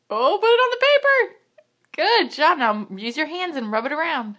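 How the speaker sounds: loud, high, delighted praise; excited. Real, not acted. Excited praise to painting toddler.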